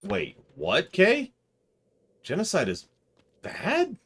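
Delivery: high, squeaky rises on the key words; mock disbelief. Streamer reacts to Kay's genocide remark, mock disbelief. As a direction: feign shock with leaping questions.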